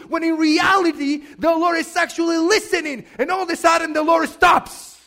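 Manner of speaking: loud, insistent sermon delivery; full-force preaching. Real, not acted. Pastor urging the congregation not to stop praying, preaching forcefully. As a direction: drive each phrase home, building in volume.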